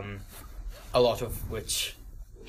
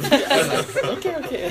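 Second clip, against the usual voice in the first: laughing; 13 semitones higher, 6 dB louder than usual. Retold or imitated. Laughing while retelling the waiter story.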